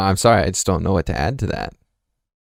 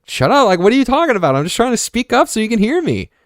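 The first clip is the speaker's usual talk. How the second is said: high, raised, exasperated protest; retold talking back. Son protesting to Mom that he only spoke up, exasperated and high. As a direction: imitate an indignant kid arguing back. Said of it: "Don't give me an attitude".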